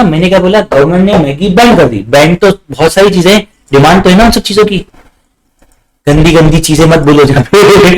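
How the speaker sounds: ordinary delivery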